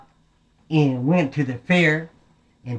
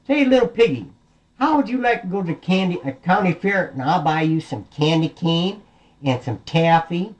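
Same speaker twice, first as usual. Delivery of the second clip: coaxing big bad wolf; 5 semitones higher than usual. Wolf luring the piggy to the fair, raised coaxing call.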